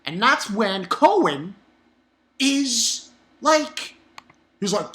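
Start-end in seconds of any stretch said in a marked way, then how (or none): none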